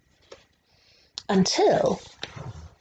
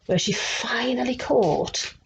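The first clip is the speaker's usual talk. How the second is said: plain reading voice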